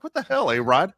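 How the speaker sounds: mock outrage. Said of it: "everyone's like".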